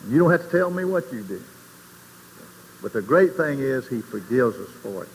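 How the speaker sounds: ordinary talk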